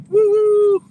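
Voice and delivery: loud high whoop of excitement; excited whoop. Real, not acted. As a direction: let out a big hype cheer. Hyped before the race.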